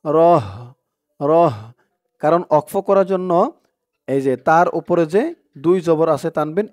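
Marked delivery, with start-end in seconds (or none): 0.0-1.5 "রহ" ending on an audible breathed h; stopped final ha. Shown as a demonstration. Teacher shows the stop on gul ta, ending "রহ" on h. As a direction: close "রহ" on a vowelless h. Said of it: "র দিয়ে হা ধরলে যেমন উচ্চারণ হয়".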